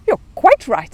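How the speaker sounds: high, loud, eager reply; excited. Acted. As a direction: burst out eagerly. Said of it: "said Paddington excitedly".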